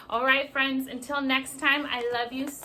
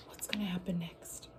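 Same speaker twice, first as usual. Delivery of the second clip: whisper; 5 semitones lower, 10 dB quieter, faster than usual. Acted. Suspense aside to kids.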